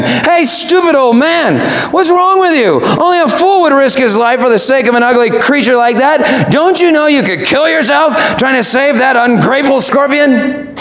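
a passerby's half-hearted scornful yell; mocking yell. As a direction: jeer at him from the riverbank. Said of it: "he yelled to the old man".

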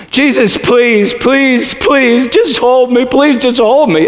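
high, slow, broken begging as the woman in the vision; a woman crying out to be held; tearful pleading. Retold or imitated. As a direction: plead with Jesus through tears. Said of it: "and she cried".

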